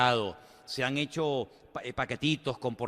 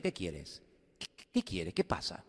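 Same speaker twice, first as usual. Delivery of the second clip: low, hushed royal questioning; king questioning; 8 semitones lower, 7 dB quieter than usual. Acted. King asks his cupbearer what he wants, low and quiet. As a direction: drop right down into a soft, deep query.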